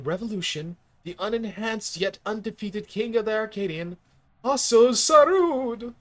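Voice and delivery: booming grand ceremony announcer; blustery pompous mayor. Acted.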